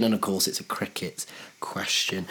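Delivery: whispered cricket quip; whispered aside. Real, not acted. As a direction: whisper it like a secret. Aside during the quiz.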